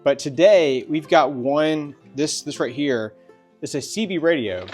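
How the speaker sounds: usual speaking voice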